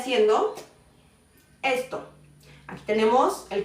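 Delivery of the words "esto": ordinary talk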